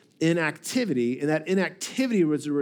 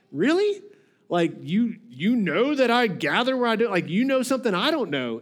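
incredulous questioning; 5 semitones higher than usual. Acted. Master doubting what the servant claims to know, incredulous. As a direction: leap high on "really", stay raised and questioning. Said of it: "his question mark shows he's like".

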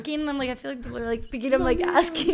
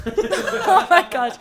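laughing; 8 semitones higher, 10 dB louder, much slower than usual. Real, not acted. Laughing at the name.

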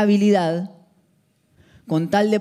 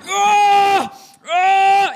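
two high, loud strained yells; show-off weightlifter yelling; mock gym yell; 13 semitones higher, 7 dB louder than usual. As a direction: ham up a lifter's exaggerated effort shout. Mimics gym guy yelling.

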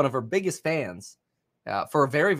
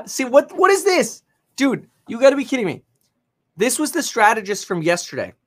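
high, fast, stunned disbelief; can't believe what he's reading; 8 semitones higher, 6 dB louder than usual. Real, not acted. Reading a shocking headline.

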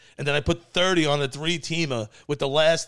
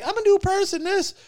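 imitated girlfriend; 4 dB louder than usual. Retold girlfriend's claim next day.

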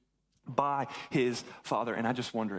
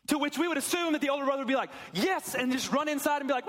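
high, excited preaching voice; preaching at full force; 11 semitones higher, 3 dB louder than usual. Acted.

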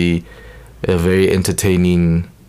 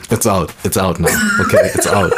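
laughing. Real, not acted. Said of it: "Just let it out".

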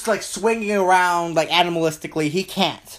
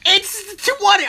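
gloating; 13 semitones higher, 4 dB louder than usual. Real, not acted. Gloating over roll.

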